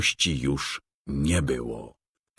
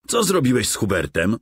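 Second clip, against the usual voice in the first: higher, fast, worried cry; anxious exclaim; 6 semitones higher, faster than usual. A narrator's reading. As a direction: blurt it out full of dread. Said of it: "Pełen złych przeczuć wykrzyknął".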